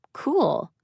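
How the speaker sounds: high, faux interested cool; fake interest. A narrator's reading. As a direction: pretend to be impressed. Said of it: "faux comprehending tone".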